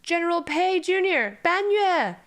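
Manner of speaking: high shout calling into the distance; urgent shouted warning. Acted. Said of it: "he shouts in the Banyue tongue".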